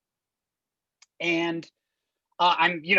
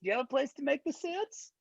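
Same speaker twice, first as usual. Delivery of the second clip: put-on high, soft Borat accent; 7 semitones higher, 6 dB quieter than usual. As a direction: Borat accent asking politely. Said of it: "do the entire demo in Borat voice".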